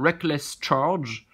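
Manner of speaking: drawn-out English with a French accent. Real, not acted. French collector reads out an English attack name, French-accented English. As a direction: stretch out the English words, French accent and all. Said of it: "mon accent anglais est carrément super bon".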